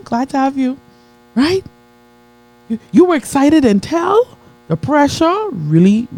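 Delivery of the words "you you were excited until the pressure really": building preach. Acted. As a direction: stretch each word to the crowd.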